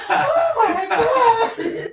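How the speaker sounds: high, loud, very drawn-out wail; hammed-up horrified reaction; mock shock. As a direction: stretch it out in outraged disbelief, laughing. Retells brother's shocked reaction.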